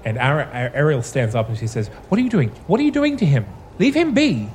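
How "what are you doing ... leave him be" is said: alarmed. Acted. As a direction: demand he stop, frightened. NPC Ariel alarmed at the ritual.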